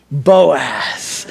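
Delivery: very low, drawn-out name; mock swooning. Preacher urging guys to name sons after him, mock swooning. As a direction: linger on the name, deep and savoured.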